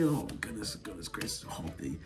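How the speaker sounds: very low, hushed retold plea; begging under the breath. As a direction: plead almost inaudibly to yourself. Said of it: "I'm just over here begging".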